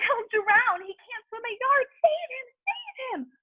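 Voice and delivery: very high, tearful panic; high frantic mother's plea; panicked pleading. A narrator's reading. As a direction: beg with a breaking voice. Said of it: "screamed Missus Gloop".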